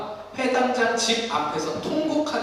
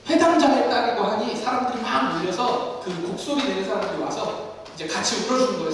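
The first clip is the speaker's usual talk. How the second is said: normal talking voice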